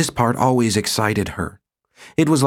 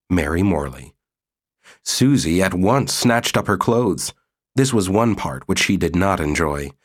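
usual speaking voice

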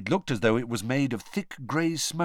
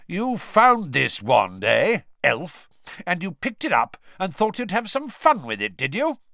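menacing official; 8 semitones higher, 6 dB louder than usual. A narrator's reading. Diggory grilling the cowering elf, loud and menacing. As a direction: lean over the elf and grill her.

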